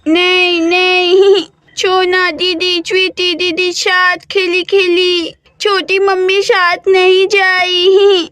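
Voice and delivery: put-on slow, tearful toddler whine; a toddler crying that he won't go with his aunt; crying little boy. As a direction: sob the words out like a stubborn small child. Small boy crying, refusing to go.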